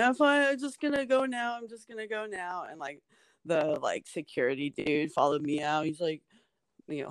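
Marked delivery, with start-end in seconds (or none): 0.0-2.6 high-pitched imitated crying voice, fading away; retold tearful voice. Crying comic excusing themself to the crowd, high mock-tearful voice. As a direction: mimic insisting through tears, high and trailing off. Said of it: "So I was, like, crying".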